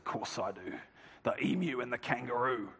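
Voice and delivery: low, hushed imitation of an Australian cricket captain; imitating Kim Hughes. Former captain addressing a cricket dinner, low gravelly Australian imitation. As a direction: retell it quietly, pitched well down, as the Australian speaker. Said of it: "in his gravelly Australian voice".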